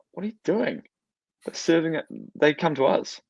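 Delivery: imitating the bride. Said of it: "the bride was like".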